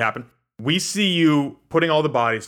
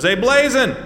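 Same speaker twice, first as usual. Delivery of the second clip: calling out; 10 semitones higher, 5 dB louder than usual. Acted. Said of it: "he goes".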